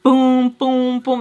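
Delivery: loud, drawn-out booms; imitated alarm booms. Vlogger as her radar going off, booming alarm. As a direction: sound out an internal alarm loudly.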